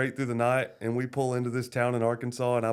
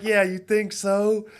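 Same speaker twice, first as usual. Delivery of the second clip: higher, slow, laughing sarcasm; sarcastic; 11 semitones higher, 7 dB louder, slower than usual. Retold or imitated. Teasing his wife after the rough night, laughing sarcasm. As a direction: drag it out through a laugh.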